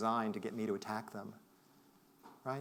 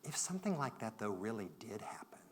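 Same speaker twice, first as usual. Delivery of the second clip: 1 hushed question; 2 semitones lower, 7 dB quieter than usual. Retold or imitated. Colleague's gentle question quoted.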